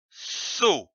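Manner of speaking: "So" said slowly and distinctly as a model; clear syllable demo. Shown as a demonstration. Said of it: "c'est dit O".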